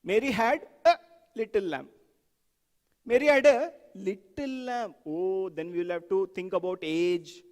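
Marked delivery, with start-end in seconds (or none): 0.0-1.9 "a" punched alone between two pauses; stop, hit "a", stop, then finish; stress on A. Shown as a demonstration. Teacher emphasizing each word in turn, "a" isolated and stressed. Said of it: "You emphasize each word". 3.0-5.0 "little" set apart and stretched; stress on LITTLE. Shown as a demonstration. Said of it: "You emphasize each word".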